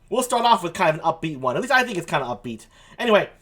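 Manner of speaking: ordinary talk, nothing marked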